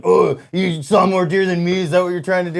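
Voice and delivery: mock-challenging buddy quote; friend's reaction. Retelling friend's reaction to deer count.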